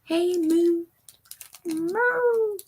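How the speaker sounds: pet talk. Real, not acted. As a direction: coo the cat's name up high. Calling to her cat.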